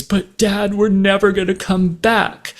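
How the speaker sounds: put-on a child begging her dad; voicing worried daughter. Girl's worried plea retold.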